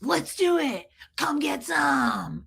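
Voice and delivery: slow taunting challenge, a mock shout; mock fighting taunt. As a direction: dare him to fight, hammed up. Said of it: "rip my clothes off and be like".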